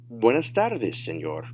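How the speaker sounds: fluent, connected "Buenas tardes, señor"; advanced-level demo without stretching; natural speed. Shown as a demonstration. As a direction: say it in one run at native pace. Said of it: "say them at natural speed".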